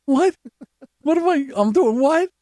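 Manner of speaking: high, incredulous squeak; startled teen self. Retold or imitated. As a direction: react as the shocked kid. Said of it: "I'm like".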